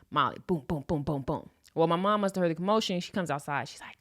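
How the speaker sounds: ordinary talk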